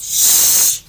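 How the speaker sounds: sharp, loud unvoiced shush. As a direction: hush him hard. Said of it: "It says".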